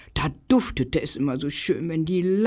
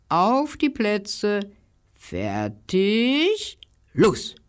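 slow race start; 4 semitones higher, much slower than usual. Acted.

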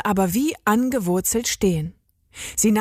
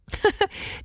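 put-on girlish giggle; 11 semitones higher, 4 dB quieter than usual. A narrator's reading. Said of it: "lachte Agathe".

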